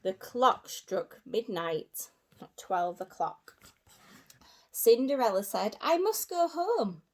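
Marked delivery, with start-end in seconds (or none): 5.8-7.0 put-on high, urgent young girl; lightly voiced princess; Cinderella's voice. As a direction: Cinderella hurrying away at midnight.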